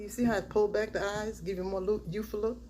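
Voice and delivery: ordinary talk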